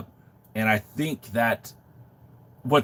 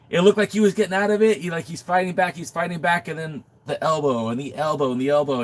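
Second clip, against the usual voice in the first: excited; 7 semitones higher than usual. Real, not acted. Reliving match excitedly.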